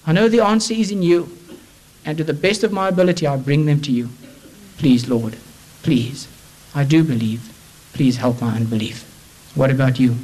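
fervent sermon voice, rising and falling; preaching. Acted. Preacher urging the congregation to find Christ, fervent sermon.

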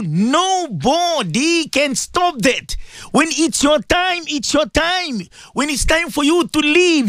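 high, ringing sermon delivery; urgent exhortation to the listeners; impassioned preaching. Real, not acted. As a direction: preach with long stretched-out key words, rising and falling. Preaches at full force.

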